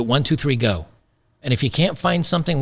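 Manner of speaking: ordinary delivery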